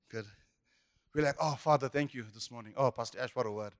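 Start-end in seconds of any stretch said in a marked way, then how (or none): 1.4-3.7 low, soft imitation of grateful churchgoer; mock pious gratitude. Churchgoer praising the pastor's word, hushed mock reverence. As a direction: softly play at being moved.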